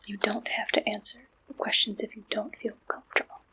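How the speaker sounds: normal talking voice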